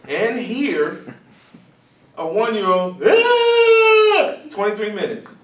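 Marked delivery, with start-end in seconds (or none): 3.1-4.3 high, loud mock baby wail. Imitating the boy's crying for the audience, high loud wail.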